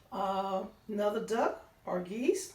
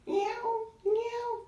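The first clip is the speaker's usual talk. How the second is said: much higher squeaky meow; meowing cat; 13 semitones higher than usual. Acted. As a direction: mew like a little cat. Cat sound in story.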